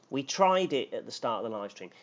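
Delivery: ordinary talk, nothing marked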